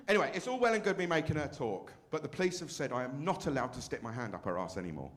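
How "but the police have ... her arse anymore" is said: ordinary talk, nothing marked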